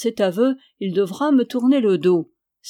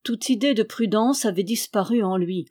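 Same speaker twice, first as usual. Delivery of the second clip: ordinary delivery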